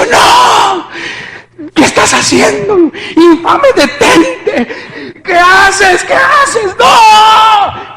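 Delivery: high, loud, tearful pleading shout; reliving terror, crying out at full voice; terrified screaming. Retold or imitated. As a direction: scream in horror at an attacker. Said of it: "era un temor muy fuerte".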